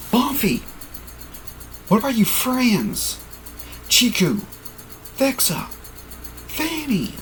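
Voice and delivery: high, fading plea; high pleading voice. Acted. Hero asks Buffy about her friends, high and pleading.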